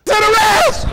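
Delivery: high, loud cry; mock citizen's arrest. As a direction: bark the arrest. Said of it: "Citizens arrest".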